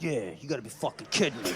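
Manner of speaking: low, grumbling voice for the dog; dog's voice. Retold or imitated. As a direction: play the dog agreeing with a grumble. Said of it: "the dog was over in the corner being like".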